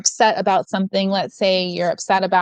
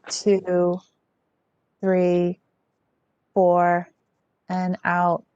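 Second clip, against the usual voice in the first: guided breathing. Acted. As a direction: ease the group into calm breathing. Leads a guided breathing exercise.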